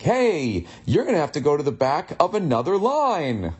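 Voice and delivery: over-the-top chirpy, high swooping delivery; mock cheer like a game-show winner; happy tone. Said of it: "say that in that happy tone".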